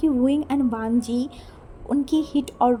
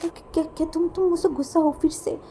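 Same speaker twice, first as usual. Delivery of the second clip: stammering, higher-pitched anxious apology; frightened pleading; 3 semitones higher than usual. Acted. Lanzan fears hurting Wing once more, stammering anxious plea. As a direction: trip over the words while begging forgiveness. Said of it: "वो डर के मारे".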